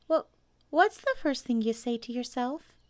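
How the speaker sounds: high, hesitant little animal; Piglet voice. A narrator's reading. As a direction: stumble into the question in a small voice. Piglet questions Pooh.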